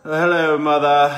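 high, loud theatrical greeting; mock posh greeting. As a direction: greet Mother like a grand dame. Greeting repeated, playful parody.

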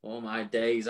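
ordinary talk

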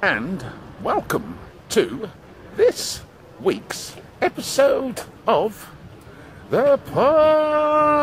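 slow, sing-song show announcer; theatrical channel intro; drawn-out intro. Acted. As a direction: stretch each word like a grand host. Show intro, stretched announcer delivery.

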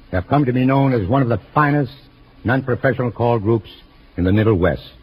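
normal talking voice

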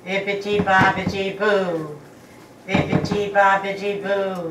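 low, hushed intoned chant; solemn, low-pitched magic chant; séance incantation. Acted. Medium begins séance incantation at table.

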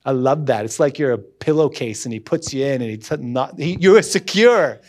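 normal talking voice

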